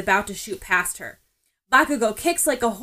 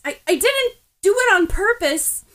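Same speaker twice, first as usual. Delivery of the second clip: much higher and louder, stumbling start; flustered protest; 10 semitones higher, 7 dB louder than usual. A narrator's reading. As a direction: panicky excuse tumbling out. Ochako flustered, defending herself.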